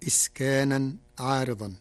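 ordinary talk, nothing marked

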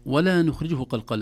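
ordinary delivery

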